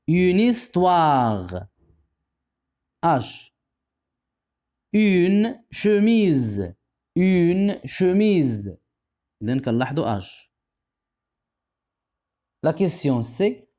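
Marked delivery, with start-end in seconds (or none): 4.9-8.8 slow dictation. Shown as a demonstration. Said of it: "teacher dictating words slowly".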